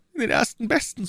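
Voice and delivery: high, miserable prisoner voice; despairing echo. Acted. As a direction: echo the callous words as a high lament. Prisoner despairingly echoes the order.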